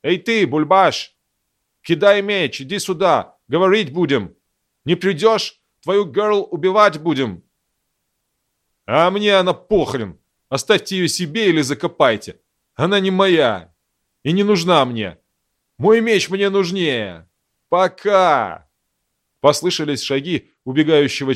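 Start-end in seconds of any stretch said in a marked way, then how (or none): 0.0-7.4 put-on shouting in an American accent. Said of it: "С тем же американским акцентом один из них крикнул". 8.9-18.7 defiant shout. Acted. Глина shouts back, fleeing.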